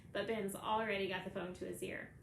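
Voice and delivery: plain reading voice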